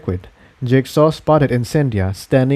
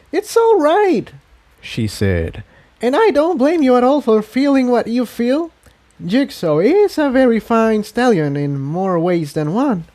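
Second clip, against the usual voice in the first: much higher, playful mare voice; teasing pony voice; 11 semitones higher than usual. A narrator's reading. Tiptoe teasing Incendia about Jigsaw, playful and high. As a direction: tease her gently, higher.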